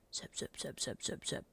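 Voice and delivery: hushed, whispered name calling; whispering. Real, not acted.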